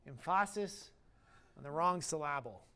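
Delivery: "Emphasis" stressed oddly on the middle syllable; "syllable" with its middle punched; misplaced stress. Shown as a demonstration. Joke misplacing stress to illustrate point.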